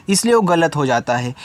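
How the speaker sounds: normal talking voice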